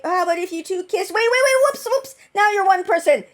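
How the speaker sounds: high, hurried mock villain quote; Dark One. Dark One casually fusing two people, high flippant imitation. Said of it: "the Dark One was just like".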